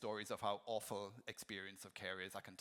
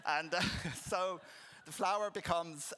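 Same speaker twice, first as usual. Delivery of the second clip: laughing; 6 semitones higher, 6 dB louder than usual. Real, not acted. Laughing at nurse anecdote.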